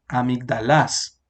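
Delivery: "amigdalas" stressed at the end, a-mig-da-LÁS; wrong stress on final syllable. Shown as a demonstration. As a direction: land hard on the LAS of "amigdalas". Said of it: "si lo ponemos aquí sería". («amigdalas» acentuado al final: amigdaLÁS)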